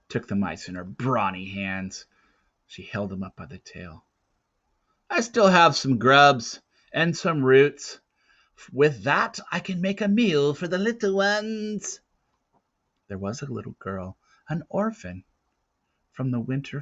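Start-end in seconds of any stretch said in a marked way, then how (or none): 5.1-12.0 put-on old woman voice. A narrator's reading.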